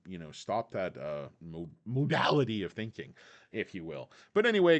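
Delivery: ordinary talk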